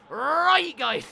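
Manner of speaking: very high, loud comic shout; mock outburst. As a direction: burst out as a silly battle cry. Mock outburst ending accent bit.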